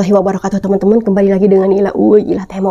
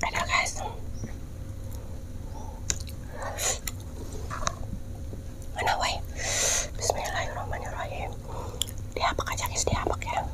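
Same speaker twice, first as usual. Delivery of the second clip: soft unvoiced whisper; 12 dB quieter than usual. Real, not acted. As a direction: whisper it close to the mic. Whispering while eating on camera.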